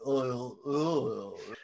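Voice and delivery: low, dazed mumbling uh; mock-dopey hesitant grunt; ridiculous voice. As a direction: imitate his daft stuck-for-words voice. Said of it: "he's got that ridiculous voice".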